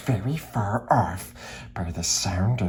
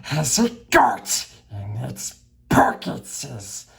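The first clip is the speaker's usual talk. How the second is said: higher, slow, hissed creature demand; Gollum hissing; 5 semitones higher, slower than usual. Acted. Gollum demands what is in Bilbo's pockets, hissing slowly. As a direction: hiss the demand with suspicion. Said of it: "came a sharp hiss".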